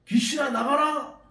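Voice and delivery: confident, high, loud order to a demon; shouted command. Retold or imitated. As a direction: cast it out with a big shout. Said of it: "소리를 칩니다".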